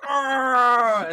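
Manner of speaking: hammed-up death cry; mock pained yell. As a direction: ham up getting shot. And he's going.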